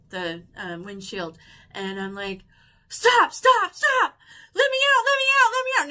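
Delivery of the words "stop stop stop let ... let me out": loud, high-pitched panicked screaming; full-on retold panic, shrieked; reenacted freak-out. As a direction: scream frantically to be let out. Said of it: "And I freaked out, freaked out, like, full on".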